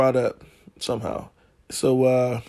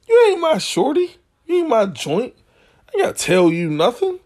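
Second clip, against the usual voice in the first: indignant; 10 semitones higher than usual. Retold or imitated. Retold angry reply to girl.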